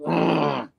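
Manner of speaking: high mock "ugh" of exasperation; frustrated growl. Spidey fed up with Slide, frustrated groan. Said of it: "you know, frustrated".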